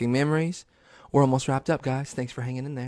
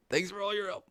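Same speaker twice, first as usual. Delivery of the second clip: soft, high-pitched mock sign-off; Steve; 9 semitones higher, 9 dB quieter, faster than usual. TV host's farewell repeated in mockery, soft high voice. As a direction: squeeze it out small and high, imitating. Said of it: "that motherfucker was like".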